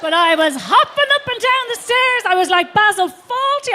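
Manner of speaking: high and loud, bouncing; manic excitement. Acted. Comic reliving the manic stair run, high and loud. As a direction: buzz with wild energy.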